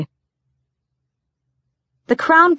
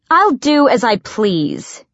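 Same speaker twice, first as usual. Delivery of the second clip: slow, higher, mildly defiant; 5 semitones higher, slower than usual. A narrator's reading. Assassin refusing her servant's warning, slow mild defiance. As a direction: stretch it out with a touch of defiance.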